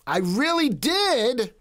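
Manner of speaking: did drawn out high and slow, a mock-pleading I really did; high, slow mock whine. Shown as a demonstration. Streamer to chat, whining high and slow. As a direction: stretch the words high and slow like a whiny plea.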